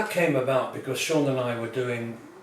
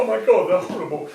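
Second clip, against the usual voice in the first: louder, higher, fast disgusted squeal; mock horrified wife; 6 semitones higher, 8 dB louder, faster than usual. Wife recoiling from the robots, mock horrified. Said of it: "And the wife would go".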